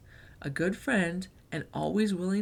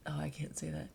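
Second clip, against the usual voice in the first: breathy whisper to herself; whispered aside; 4 semitones lower, 11 dB quieter than usual. Real, not acted. Reader whispering to herself after tripping on a word. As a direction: drop to a whisper, caught out.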